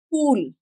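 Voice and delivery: फूल with f, lips apart; English F, fool. Shown as a demonstration. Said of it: "मूर्ख". (फूल में नुक़्ते वाला फ़)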